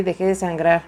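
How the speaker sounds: ordinary talk, nothing marked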